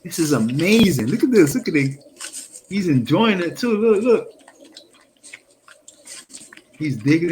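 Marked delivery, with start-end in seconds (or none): 0.0-4.3 high, delighted exclaiming at the video; real thrilled reaction; excited. Real, not acted. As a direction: gush over the clip, pitch way up.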